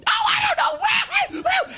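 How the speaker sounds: ditzy woman voice, way up high; mock woman. Woman shrugging off her husband's plan, in high falsetto. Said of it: "and women just go".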